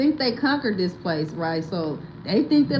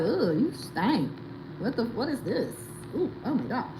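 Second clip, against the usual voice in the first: disgusted locals; 9 dB quieter than usual. Retold or imitated.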